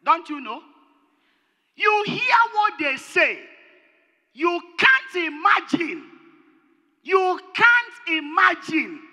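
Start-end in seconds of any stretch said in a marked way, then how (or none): 1.8-9.0 high, loud, slow preacher's cry; drawn-out loud pulpit appeal; preaching shout. Acted. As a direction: cry it out to the congregation.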